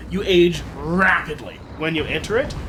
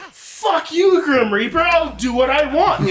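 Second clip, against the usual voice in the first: loud, higher shouted defiance, laughing at the end; defiant yell; 10 semitones higher, 5 dB louder than usual. Acted. Immortal man shouting defiance at the Grim Reaper. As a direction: yell back at death.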